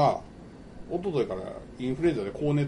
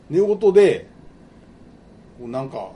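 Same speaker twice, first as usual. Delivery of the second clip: ordinary talk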